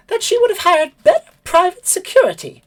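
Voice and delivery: high stormy bird voice; thunderous bird lady. Acted. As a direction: let the anger rumble under it. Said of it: "a thunderous look on her face".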